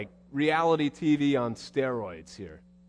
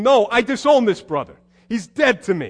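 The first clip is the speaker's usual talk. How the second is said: loud, high indignant outburst; elder brother refusing to go in, angry; angry refusal; 10 semitones higher, 10 dB louder than usual. Acted. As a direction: throw the brother away in a huff.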